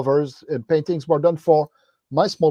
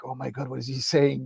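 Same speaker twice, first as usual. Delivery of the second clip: under the breath, baffled; quiet aside to yourself, lost; hushed confused aside; muttered bafflement; 7 dB quieter than usual. Retold or imitated. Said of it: "I was like".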